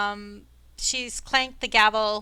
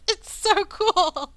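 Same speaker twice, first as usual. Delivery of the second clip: gushing; 10 semitones higher, 5 dB louder, much slower than usual. Acted. NPC gushing over spooky name.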